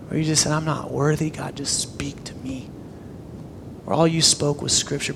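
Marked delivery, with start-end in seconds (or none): 0.5-2.7 hushed prayer, fading to near whisper; humble prayer. Acted. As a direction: let a humble plea sink almost to a whisper. Acted humble prayer to God.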